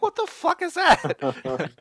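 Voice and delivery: high, loud, drawn-out mock alarm; stretched squeal of disbelief; mock startled panic. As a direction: cry out in comic horror. Acts out audience's startled reaction.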